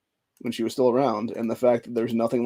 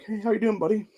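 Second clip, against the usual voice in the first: higher, softer friendly greeting; imitating the teacher; 9 semitones higher, 3 dB quieter than usual. Quoting his third grade teacher's phone call, higher and gentle. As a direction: lightly voice the caring teacher's check-in. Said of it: "she was like".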